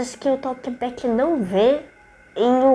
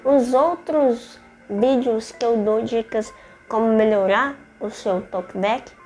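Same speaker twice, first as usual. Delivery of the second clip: ordinary delivery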